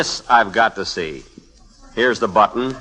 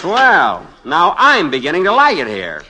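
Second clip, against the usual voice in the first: delighted; 6 semitones higher, 5 dB louder than usual. Acted. Delighted to stay jailed.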